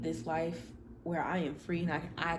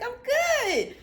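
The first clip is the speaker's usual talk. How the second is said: very high, louder, squeaky "I'm good"; squeaky high reply; 6 dB louder than usual. Retold or imitated. Answering her friend in a squeaky high voice. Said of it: "I was like".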